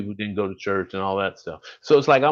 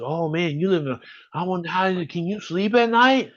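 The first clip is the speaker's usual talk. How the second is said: mock alarmed outsider; 7 semitones higher than usual. People elsewhere fear Birmingham crime.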